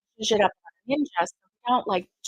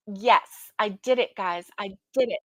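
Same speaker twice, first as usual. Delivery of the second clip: ordinary talk, nothing marked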